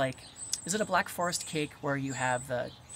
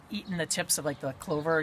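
normal talking voice

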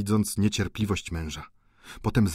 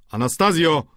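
Anastazjo called out louder and higher; calling out again; 8 semitones higher, 7 dB louder than usual. A narrator's reading. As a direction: call Anastazjo across the rooms. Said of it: "zawołał znów hrabia na żonę".

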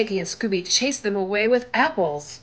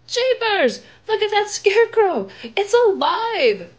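much higher and louder, drawn-out terror; frightened shriek; 13 semitones higher, 6 dB louder than usual. Acted. Girl seeing the scarecrow come alive, high frightened cry. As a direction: scream as the scarecrow moves.

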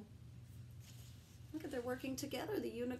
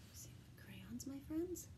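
secretive soft whisper; tiny hushed voice from a box; 3 semitones higher, 6 dB quieter than usual. Acted. Crayon box speaks.

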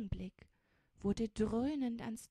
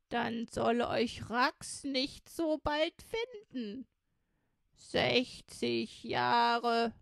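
put-on loud, higher old woman, self-satisfied; old robber woman; 4 semitones higher, 8 dB louder, slower than usual. A narrator's reading. Old woman gloats to her new helpers about a holiday, loud and slow. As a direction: crow loudly with drawn-out, slowed words.

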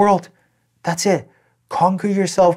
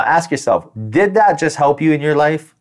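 ordinary delivery

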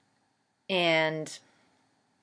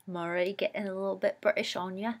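badly faked Cockney accent, quick and softer; awful British accent; 8 dB quieter, faster than usual. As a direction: put on a playful, clumsy Cockney accent. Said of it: "Awful accent. No offense to any Cockney viewers".

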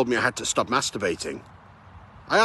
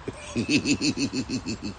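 soft chuckle at own pun; corny dad laugh; 4 dB quieter than usual. Real, not acted. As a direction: chuckle at your own joke.

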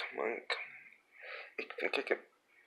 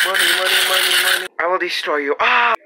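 loud high villain toy voice; 9 semitones higher, 12 dB louder, much faster than usual. Pretend.

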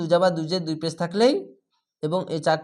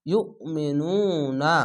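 very slow drawn-out Quranic chant; tajweed recitation demo; much slower than usual. Shown as a demonstration. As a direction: stretch every syllable of the word. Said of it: "স্লোভাবে একটু পড়ছি কেন".

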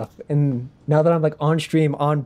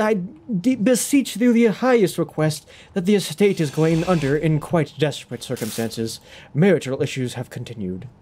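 put-on petition grandly in old-fashioned British; ye olde British accent. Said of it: "like, ye olde British".

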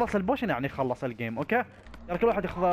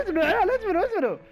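high laughing cries of wait; laughing plea; 12 semitones higher, faster than usual. Real, not acted. Host laughs as friends rush off, wait. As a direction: beg them to hold on through laughter.

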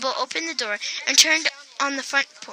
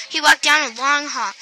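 plain reading voice

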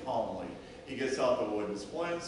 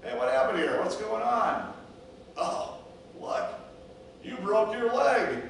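high, raised exclamations on finding someone hurt; acted startled concern; 9 semitones higher, 4 dB louder than usual. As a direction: react with alarm at the injury. Shepherd finds the injured sheep.

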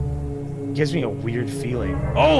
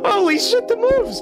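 excited; 3 dB louder than usual. Real, not acted. Excited by character's dance.

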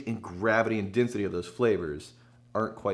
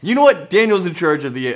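loud; 6 semitones higher, 10 dB louder than usual. Pretend. Playful loud titan bit.

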